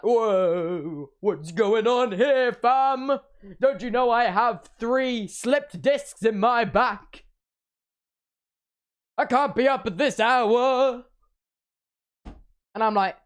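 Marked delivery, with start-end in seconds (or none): 0.0-11.0 dad voice. Retold or imitated. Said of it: "He's like".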